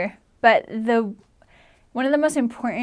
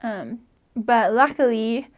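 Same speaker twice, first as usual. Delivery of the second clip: ordinary talk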